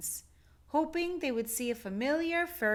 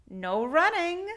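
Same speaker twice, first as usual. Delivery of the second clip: louder, higher playful call; calling after; 8 semitones higher, 6 dB louder than usual. Acted. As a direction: call it after her with a grin. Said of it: "he called after her".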